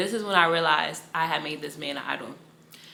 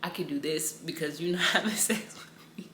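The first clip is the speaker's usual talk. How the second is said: hushed, breathy whisper of his words; whispered quote; 2 semitones lower, 4 dB quieter than usual. Retold or imitated. Retelling what her boyfriend said, in a whisper. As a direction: whisper it like a secret.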